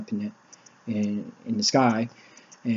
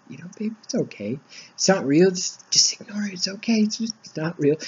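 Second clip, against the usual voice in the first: put-on higher, hushed reassurance to a scared child; calm her down gently, almost whispering; soothing daughter; 8 semitones higher than usual. Father soothing terrified daughter.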